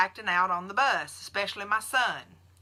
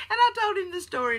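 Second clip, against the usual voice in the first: higher, faster, laughing through words; still laughing; 13 semitones higher, 4 dB louder, faster than usual. Real, not acted. Finishing the duck story to viewers, laughing. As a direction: talk through the tail of a laugh.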